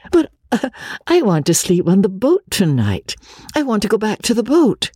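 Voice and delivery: insist, almost begging, to go back. Acted. Magnolia insists on the boat.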